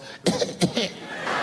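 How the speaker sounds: pointed little fake coughs; fake non-smoker cough. Woman objecting to the comedian's smoking, fake coughing. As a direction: cough for show, unconvincingly. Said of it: "one of them fake non-smoker calls".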